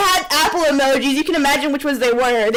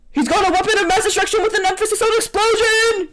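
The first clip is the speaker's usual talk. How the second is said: much higher, fast excited announcement; excited yell; 8 semitones higher, faster than usual. Acted. Charlie yelling on stage, high and fast. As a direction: blurt it out in a rush. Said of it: "Charlie yells".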